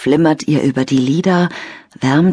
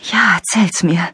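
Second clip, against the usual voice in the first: whisper. Acted. Lena whispers into phone.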